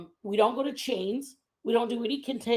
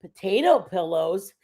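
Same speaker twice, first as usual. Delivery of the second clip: ordinary talk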